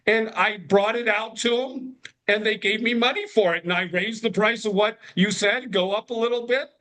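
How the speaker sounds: high-pitched, earnest retold self-defence; defensive kid. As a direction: plead your case like a child to dad. Voicing young self defending to dad.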